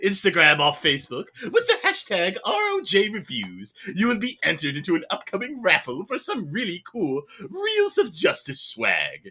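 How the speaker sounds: high, loud, jangly cartoon cat man; fat man cat voice. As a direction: ham up a jiggly fat cat announcer. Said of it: "my jimble jangle fat man cat voice".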